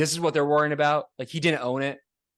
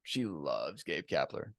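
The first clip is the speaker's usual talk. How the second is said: much lower, softer, slow deadpan; low slow tease; 13 semitones lower, 12 dB quieter, slower than usual. Real, not acted. Co-host teasing about his wife's crush, deadpan.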